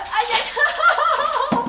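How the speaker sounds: sharp high ouch, laughing; pained ouch. Real, not acted. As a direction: yelp from the burning. Candy burns, cries out.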